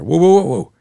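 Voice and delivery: high, loud whoa over the noise; loud, high calming whoas over a furious class; calming a roaring room. Retold or imitated. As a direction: call out to settle the crowd. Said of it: "I calm everyone down, I'm patting the air".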